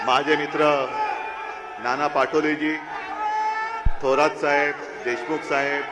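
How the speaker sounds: rousing political speech to a crowd; rally speech. Real, not acted. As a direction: address the crowd from the stage.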